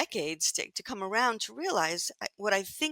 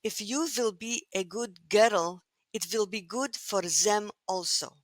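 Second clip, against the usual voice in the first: put-on slow, deliberate Russian-accented English; gently imitating a Russian rabbi's accent; thick Russian accent; slower than usual. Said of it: "in his thick Russian accent".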